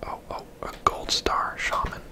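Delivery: normal talking voice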